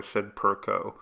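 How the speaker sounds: usual speaking voice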